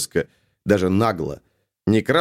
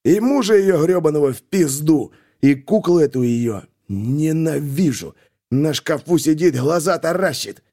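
hateful rant; 5 semitones higher than usual. A narrator's reading. Hate-filled rant about sister.

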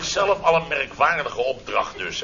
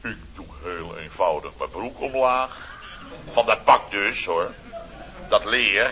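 ordinary talk, nothing marked

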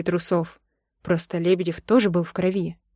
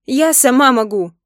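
loud, higher-pitched protest; indignant insistence; 4 semitones higher, 7 dB louder than usual. A narrator's reading. Wife grabs the soap to wash herself, indignant insistence. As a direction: insist hotly.

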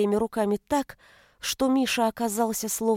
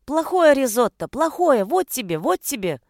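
high, loud start, dropping on the jabs; child's tantrum; 4 semitones higher, 4 dB louder than usual. A narrator's reading. Son smacking his risotto, in a tantrum. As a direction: punish the food like a cross little boy.

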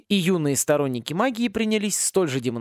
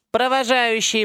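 tinny loudspeaker call, louder; rattling speaker voice; 9 semitones higher, 5 dB louder, slower than usual. Acted. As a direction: conductor over a rattling speaker. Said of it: "продребезжала проводница в динамике". (дребезжащий голос из динамика)

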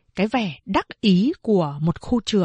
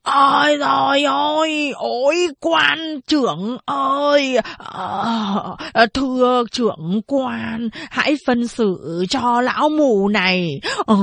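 wailing cry; 4 semitones higher, slower than usual. Acted. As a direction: howl it out like a kowtowing beggar. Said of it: "gào khóc".

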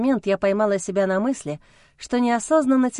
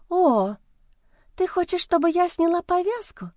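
cutesy voice; 9 semitones higher than usual. A narrator's reading. Mistress voiced in story.

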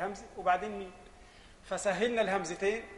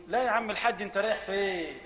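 ordinary talk, nothing marked

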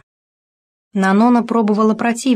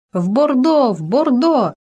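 crying out; 6 semitones higher than usual. A narrator's reading. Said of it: "закричала Нанона из кареты".

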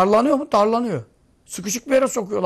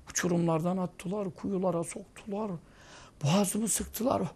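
shaken hush; 10 dB quieter than usual. Retold or imitated. Dreamer recounts nightmare to wife.

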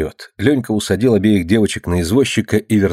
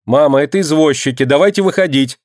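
higher, mildly raised call to mother; лёгкий крик; 6 semitones higher than usual. A narrator's reading. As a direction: call out excitedly, without a full shout. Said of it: "закричала Алина". (негромкий крик повыше)

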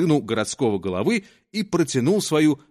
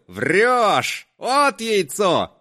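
put-on drunk's boom; 6 semitones higher, much slower than usual. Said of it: "загудел".

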